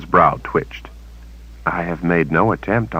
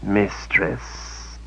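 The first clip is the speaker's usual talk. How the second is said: a soft purred creature voice, much softer; purring; 3 semitones higher, 8 dB quieter than usual. A narrator's reading. Servant creature greeting his mistress, softly purred. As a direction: purr it like a pet. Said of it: "he purred".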